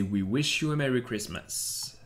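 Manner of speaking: slow, affected posh English accent. Shown as a demonstration. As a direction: put on a snooty English accent. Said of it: "avec un accent anglais de poufias".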